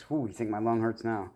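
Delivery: usual speaking voice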